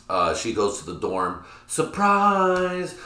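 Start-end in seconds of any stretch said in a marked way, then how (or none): 1.7-2.9 drawn-out, high "surprise" call; hammed-up party arrival, sung out high; mock surprise entrance. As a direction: announce yourself with a big high flourish. Acting out mom's dorm entrance.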